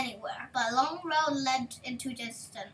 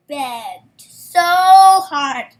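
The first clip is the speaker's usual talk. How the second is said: slow, much louder stress on begged so hard; drawn out; 19 dB louder, much slower than usual. A narrator's reading. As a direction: lean hard into begged so hard, slowly. Narrator stretches the begging.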